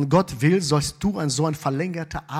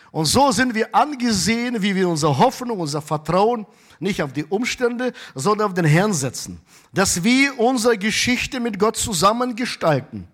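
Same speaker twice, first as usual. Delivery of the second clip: raised, loud, fervent preaching; full-force preaching; 6 semitones higher, 4 dB louder than usual. Acted. Pastor urging the congregation, full-force fervent preaching. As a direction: preach it with rising fire.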